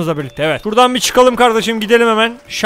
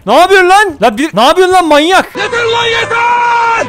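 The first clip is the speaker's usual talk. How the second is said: higher, louder angry yell at a madman; angry shout; 8 semitones higher, 3 dB louder than usual. Real, not acted. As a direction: yell at him to stop, much higher. Yells at crazy attacker. (deliye bağırarak öfkeyle haykırış)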